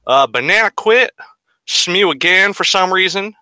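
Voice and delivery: hammy mock Southern drawl; southern accent. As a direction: put on a bad Southern accent. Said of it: "in, like, a shitty southern accent".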